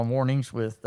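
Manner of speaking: usual speaking voice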